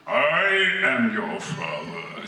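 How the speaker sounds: drawn-out, low megaphone villain; Darth Vader. Acted. Masked villain reveals himself to his son, slow and low. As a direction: stretch it out slowly like a masked villain. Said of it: "Vader is on a megaphone".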